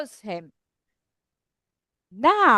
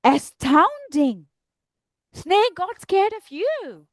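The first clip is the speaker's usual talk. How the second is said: slow, drawn-out astonishment; amazed Gruffalo; 4 semitones higher, slower than usual. Acted. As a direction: stretch every word in wonder. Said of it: "And the Gruffalo says".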